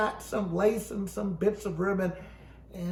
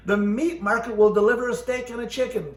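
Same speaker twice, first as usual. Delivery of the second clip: ordinary delivery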